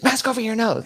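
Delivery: high, loud mock command; shrieky send-up of an exasperated official; imitated TSA yell. TSA lady to passenger playing oblivious, mock yelled order.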